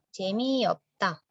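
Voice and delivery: Jemiopta spelled out slowly, each syllable clear; slow Korean demo. Shown as a demonstration. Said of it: "yang dibaca hanya biepnya saja". (ucapkan Jemiopta perlahan)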